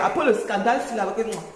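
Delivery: ordinary talk, nothing marked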